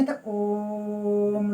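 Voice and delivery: "ओम" stretched out very slow and soft, chant-like; long drawn om. Shown as a demonstration. Teacher demonstrates pluta vowel to students, slow chant. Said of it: "इसी को तो आप ये उच्चारण करेंगे".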